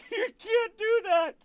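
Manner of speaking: very high, slow, squeaky mock protest; imitating a whiny voice. Mocking a player's protest in a squeaky high voice. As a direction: whine it up high like a cartoon. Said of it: "You're just like".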